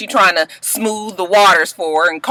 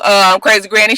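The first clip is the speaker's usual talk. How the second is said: ordinary talk, nothing marked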